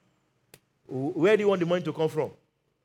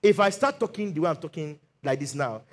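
ordinary delivery

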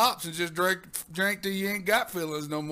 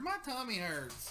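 put-on whiny kid voice; 4 semitones higher, 9 dB quieter, slower than usual. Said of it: "but I heard".

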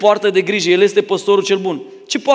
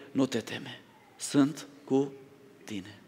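much softer, lower, near-whispered comfort; calm the frightened one in a hush; the good shepherd answering a scared believer, hushed and tender; gentle reassurance; 6 semitones lower, 14 dB quieter, much slower than usual. Pretend.

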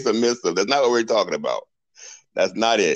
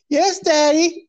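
mock submissive; much slower than usual. Man mocks the submissive wife, high and squealing. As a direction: play up a meek little yes. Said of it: "you're thinking submissive".

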